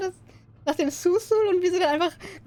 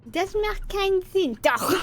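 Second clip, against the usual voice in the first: ordinary talk, nothing marked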